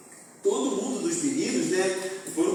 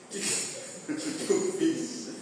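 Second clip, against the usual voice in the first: stunned whisper; 3 semitones higher, 6 dB quieter, much slower than usual. Retold or imitated. Retold shock at bad haircut.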